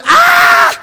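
very high, loud mock shriek; a shocked scandalized cry; horrified gasp-shriek. Preacher acting the Pharisees' outrage, high mock shriek. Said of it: "everyone went".

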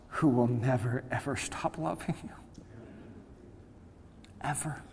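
fading to a near-whisper; very soft, intimate assurance; hushed tenderness. Real, not acted. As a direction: let the voice fall away softly. Tender close of the sermon.

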